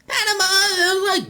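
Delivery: very high, stretched-out squeal; Patamon. Shown as a demonstration. Co-creator redoing the wrong take, very high squeaky cry. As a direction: cry the name out in a tiny cartoon voice. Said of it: "And that was wrong".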